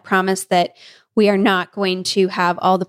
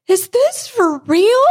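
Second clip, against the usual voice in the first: teary disbelief; 11 semitones higher, 4 dB louder, slower than usual. Retold or imitated. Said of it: "then Caitlin starts crying".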